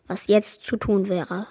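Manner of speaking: ordinary delivery, nothing marked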